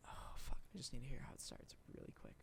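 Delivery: quick hushed aside to self; whispered aside. Real, not acted. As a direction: mutter it fast under your breath. Muttering to self before quoting.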